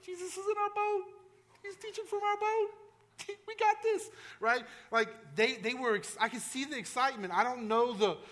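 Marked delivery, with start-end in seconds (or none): 0.0-4.1 much higher, giddy whisper-to-a-friend voice; high, gleeful voicing of James and John; excited disciples. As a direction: play the thrilled fishermen nudging each other, with a laugh. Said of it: "giving each other the look like".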